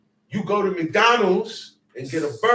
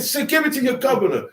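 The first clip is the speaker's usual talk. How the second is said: challenging God; 7 semitones higher, faster than usual. Retold or imitated. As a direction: toss the challenge out quickly. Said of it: "He said".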